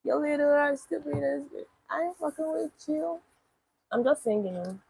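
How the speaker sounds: ordinary talk, nothing marked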